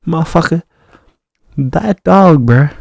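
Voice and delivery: loud, slow, exasperated yell of disbelief; real angry shout at the start of a rant; exasperated outburst. Real, not acted. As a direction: blurt it out loud, fed up and baffled.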